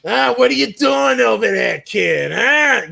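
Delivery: high, loud New England call-out; New England accent. Acted. Transplant showing how she called people kid, loud New England accent. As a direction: holler it like a New England local. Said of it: "I did start calling people kid".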